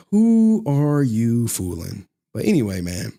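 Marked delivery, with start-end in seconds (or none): 0.1-2.1 "Who are you fooling?" drawn out slowly, each word weighed; slow it right down to rub it in; pointed, deliberate slow repeat; slow emphatic repeat. Shown as a demonstration. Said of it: "who are you fooling, man?".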